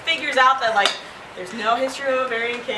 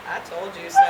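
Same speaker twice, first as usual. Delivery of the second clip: smug nurse; 4 semitones lower, 6 dB quieter, faster than usual. Retold or imitated.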